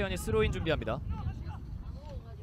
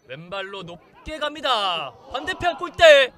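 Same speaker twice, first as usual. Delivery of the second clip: rising excitement; 3 semitones higher, 9 dB louder, slower than usual. Acted. Live play-by-play build-up.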